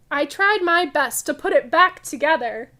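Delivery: loud, lively picture-book read-aloud; reading to children. Acted. Teacher reading a funny museum story to kids, loud lively read-aloud. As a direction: read it big and bright for the class.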